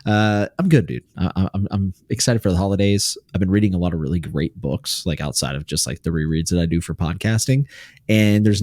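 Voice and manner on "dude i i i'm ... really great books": usual speaking voice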